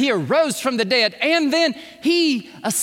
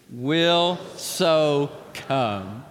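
slow group recitation; 6 semitones lower, much slower than usual. Shown as a demonstration. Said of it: "On the count of three".

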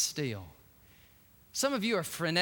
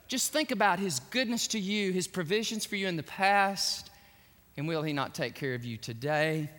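normal talking voice

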